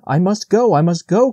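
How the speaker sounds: high, desperate insistence; frantic cry. A narrator's reading. Young deer hearing the call again, frantic. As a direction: cry out, nearly out of your wits. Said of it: "nearly out of his wits".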